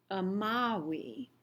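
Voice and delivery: amawi with a W sound, not V. Shown as a demonstration. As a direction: say amawi as ah-MAH-wee. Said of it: "Remember that V sounds like a W".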